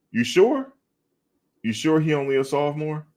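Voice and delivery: high-pitched, incredulous questioning; surprised disbelief. Real, not acted. Doubts news about the player's year.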